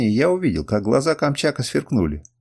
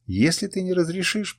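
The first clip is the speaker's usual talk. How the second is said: higher, mildly pleading voice; tearful; 6 semitones higher than usual. A narrator's reading. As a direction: plead with a touch of tears. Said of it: "плакал Африс". (с лёгкой слезой, умоляюще)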